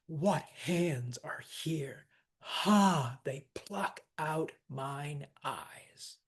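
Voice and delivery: slow, weighted beats on What hands, Ha, pluck out, mine eyes; spondaic verse reading. Shown as a demonstration. Teacher reading Macbeth's line after the killing, slow heavy stresses. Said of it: "you have two spondies in the first line".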